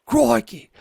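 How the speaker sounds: hammed-up Aussie "crikey", high and loud; big, badly faked Australian accent. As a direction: shout it like a mock Australian TV adventurer. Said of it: "Can I read with an Australian accent?".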